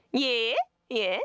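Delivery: lovely lilting Australian tone, pitched high; Australian accent, rising endings. Retold or imitated. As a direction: deliver insults sweetly in an Australian upward lilt. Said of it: "they go up at the end of every sentence".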